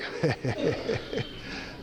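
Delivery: short breathy forced chuckle; fake laugh. Shown as a demonstration. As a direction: toss off a hollow little laugh. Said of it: "it is fake".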